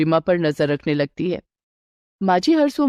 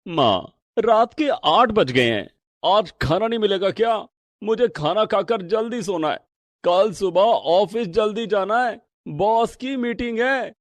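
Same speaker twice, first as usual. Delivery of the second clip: son asking for dinner. Acted. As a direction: ask mother for food, tired. (बेटे की धीमी, थकी सी आवाज़)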